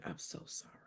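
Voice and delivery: barely voiced, hushed apology; quiet condolence. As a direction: let it drop almost to nothing. Retells consoling her crying friend.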